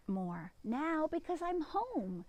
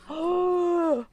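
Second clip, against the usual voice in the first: 1 dramatic gasp; 6 semitones higher, 7 dB louder than usual. Acted. Peter meets Mister McGregor.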